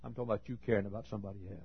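fast, soft, pointed clarification; rapid correction. Real, not acted. Preacher setting the congregation straight, quick and soft. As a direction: rattle off the correction.

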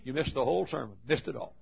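ordinary delivery

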